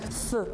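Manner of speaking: th as voiceless breath, as in thin; Icelandic letter sound. Shown as a demonstration. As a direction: sound out th with air only. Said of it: "a whole other Icelandic letter".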